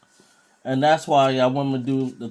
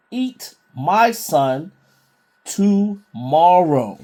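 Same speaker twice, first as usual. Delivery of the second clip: very slow, weighty Bible reading; scripture reading; much slower than usual. Acted. Preacher reading the woman's plea from Second Kings, slow emphatic scripture reading. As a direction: draw out each word of the verse.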